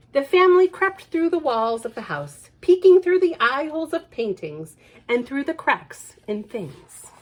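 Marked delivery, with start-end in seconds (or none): none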